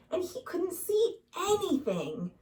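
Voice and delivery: ordinary delivery, nothing marked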